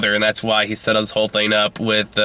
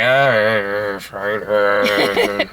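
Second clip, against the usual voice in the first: grudging sigh of consent; begrudging; 3 semitones lower, much slower than usual. Retold or imitated. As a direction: give in unwillingly. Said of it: "I did it begrudgingly".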